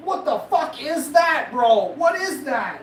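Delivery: high, loud stunned yell at the toe; shocked outburst. Retold or imitated. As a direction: blurt it out in disbelief. Shocked at friend's huge toe.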